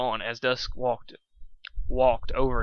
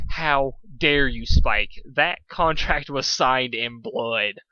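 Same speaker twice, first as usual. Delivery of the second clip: loud, slow, outraged scolding; furious outburst; 6 dB louder, much slower than usual. Acted. Pony scolding Spike for telling embarrassing secrets, furious. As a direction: thunder it out slowly, outraged.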